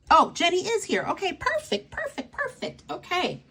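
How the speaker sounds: very high, excited relief; high squeal of joy as a friend joins; delighted squeal. Real, not acted. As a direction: squeal with delight that she arrived. Delighted Jenny arrived.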